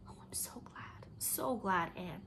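breathless whispered relief; relieved whisper. Acted. Leslie relieved that Anne forgives her, whispered.

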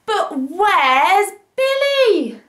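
worried high question. Acted. Girl notices Billy is missing, worried. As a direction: call out in sudden alarm. Sudden worry in story.

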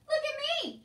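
very high, loud crow; mocking show-off. A narrator's reading. Uncle clowns as a mock king.

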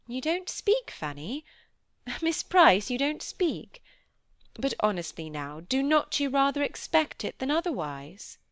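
high, lilting questioning voice; probing, playful lady. Acted. Miss Crawford pressing Fanny to speak, teasing and high. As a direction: coax an answer out of a silent friend.

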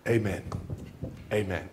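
low, soft amen, fading on the repeat; quiet closing amen. Shown as a demonstration. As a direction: settle it down gently. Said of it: "to say".

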